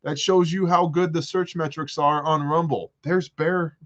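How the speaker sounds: high, insistent exasperation; exasperated. Real, not acted. Answering everybody who says he's missing from Rumble, exasperated. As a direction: protest it high, hitting the opening hard. Exasperated rebuttal to nagging viewers.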